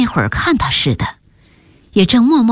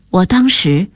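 plain reading voice

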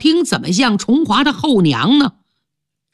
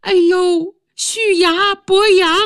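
put-on high, surprised old woman's cry; a woman caught in the muck calling up, pitched high; startled stepmother; 10 semitones higher, slower than usual. Stepmother in the pit, startled, calling up to the men. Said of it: "人女一看".

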